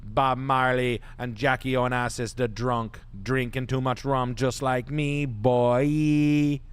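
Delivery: lightly put-on Jamaican patois; hint of a mock Jamaican accent; [name] fake patois. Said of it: "That's your [name] impression there".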